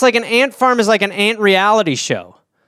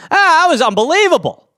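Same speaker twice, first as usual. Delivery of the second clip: higher, overexcited praise; gushing judge; 8 semitones higher than usual. Acted. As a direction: rave about everything. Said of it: "This is me as a judge".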